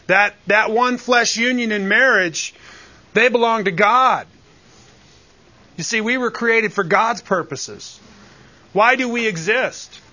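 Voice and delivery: rising sermon fervor; fervent preaching. Acted. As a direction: press the point home to the congregation.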